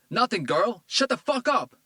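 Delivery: put-on man yelling at his girlfriend; furious yell. A narrator's reading. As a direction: scream it at her. Tito yells at girl.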